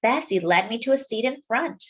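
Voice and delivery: ordinary delivery, nothing marked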